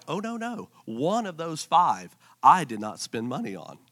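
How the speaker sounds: softly played backpedalling; mock sheepish defence. Spouse caught overspending, quietly defensive. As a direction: drop soft and low to protest innocence.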